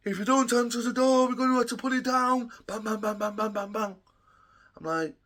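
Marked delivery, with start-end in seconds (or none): 0.1-2.5 higher and louder, a warning shouted through the door; police threat. Retold or imitated. Police warning him through the door, shouted higher. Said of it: "the police were like". 2.7-4.0 higher-pitched mock knocking noises; imitated banging. Police ramming his door, mimicked banging. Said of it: "imitating police ramming the door".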